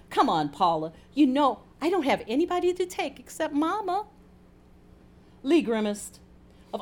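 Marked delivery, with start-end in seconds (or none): 0.1-4.1 mild coaxing, rising higher toward the end; pleading. A narrator's reading. Lee persuading Paula to cover for her, pleading. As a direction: coax her, lifting the second sentence. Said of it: "pleaded Lee".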